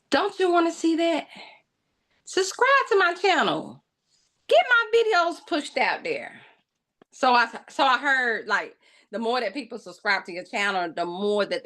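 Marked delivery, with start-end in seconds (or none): 0.1-6.4 high, sing-song coaxing; playful pleading. Pretend. Vlogger coaxing viewers to subscribe, high playful plea. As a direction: coax them to subscribe, playfully.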